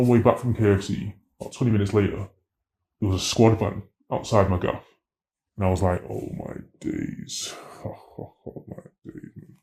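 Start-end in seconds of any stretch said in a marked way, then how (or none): 6.1-9.5 whispered, slow oh my days; hushed whisper. Retold or imitated. Seeing police van outside his home, whispered in dread. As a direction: breathe it out in stunned dread.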